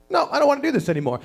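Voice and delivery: put-on high, rushed toddler protest; two-year-old. Two-year-old to dad halfway across the street, high hurried protest. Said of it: "the two-year-old's like".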